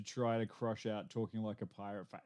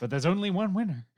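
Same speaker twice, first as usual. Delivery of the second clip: mock villain line; 7 semitones higher, 5 dB louder than usual. Dark Knight parody line.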